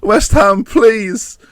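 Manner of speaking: high, fading begging; desperate pleading. Real, not acted. Fan begging his team after the loss. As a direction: plead to the team, losing hope.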